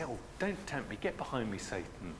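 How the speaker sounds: soft, teasing jest; joking. Retold or imitated. Friend teasing someone who needles him, joking and soft. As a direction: toss it off lightly as a joke. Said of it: "a sort of a joking way".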